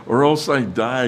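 high, loud desperate demand, easing at the end; demanding outburst. Acted. Jealous Rachel demanding children from Jacob, high loud outburst. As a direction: cry it out as an exasperated demand. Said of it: "all the complaining and all the demanding".

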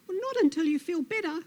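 high, quick lizard voice; stubborn Lizard. Acted. Lizard refuses to leave Snake, cheerfully stubborn.

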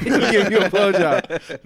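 laughing through the words, slow. Real, not acted. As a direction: crack up while repeating it. Repeating joke while laughing.

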